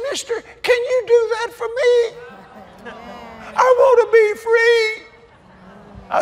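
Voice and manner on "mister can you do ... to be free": put-on high falsetto young woman pleading; imitating young woman. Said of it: "And she said".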